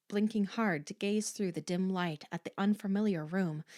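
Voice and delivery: ordinary delivery, nothing marked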